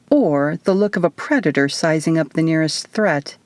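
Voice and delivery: ordinary delivery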